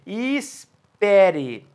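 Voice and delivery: slow, lingering wait; drawn out and slow. Shown as a demonstration. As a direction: stretch e espere out very slowly, like a pause. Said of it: "solte os braços cruzados".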